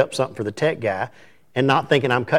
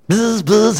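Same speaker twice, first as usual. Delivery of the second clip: alarm buzz; 10 semitones higher than usual. Retold or imitated. Imitating phone alarm going off.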